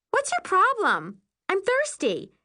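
high, indignant retort. Acted. Thirsty Carly challenges the narrator, indignant and high.

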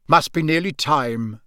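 usual speaking voice

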